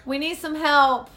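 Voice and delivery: loud call across the house. Real, not acted. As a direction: shout for dad from another room. Calling out to dad.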